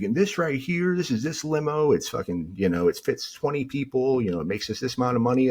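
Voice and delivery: high-pitched put-on sales patter; imitated eager limo pitch. Flustered man showing off limo to wife.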